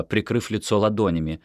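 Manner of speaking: ordinary delivery, nothing marked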